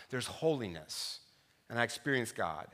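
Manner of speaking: preacher sharing a holy moment; hushed reverence. Real, not acted. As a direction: let it land quietly. Reflective close.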